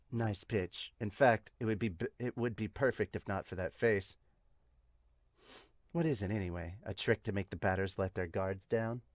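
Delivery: low, soft character voice; musing catcher. Acted. Catcher puzzling over pitcher's face, low musing voice. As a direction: muse quietly, pitched low.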